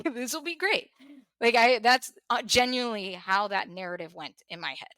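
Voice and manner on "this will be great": higher, breezy mock voice of someone shrugging off a loss; a hint of a callous relative, played light; imagined relieved family. Imagining family shrugging off her death, in a higher, breezy mock voice. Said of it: "that's how I felt the reaction would be".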